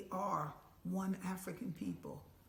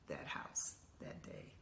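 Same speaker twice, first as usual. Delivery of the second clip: whispered close; 9 dB quieter than usual. Real, not acted. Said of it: "narration trailing".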